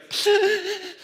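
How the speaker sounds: high, laughing imitation of a kid's shriek; child's piercing wail. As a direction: mimic a small child's wail through a laugh. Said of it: "piercing noise that only five, six-year-old kids can make".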